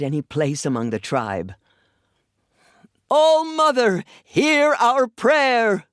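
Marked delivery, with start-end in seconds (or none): 3.1-5.9 high, loud, drawn-out prayer call; ceremonial cry. Acted. Matriarch leading the tribe's prayer, high slow ringing cry. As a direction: cry the prayer out slowly to the heavens.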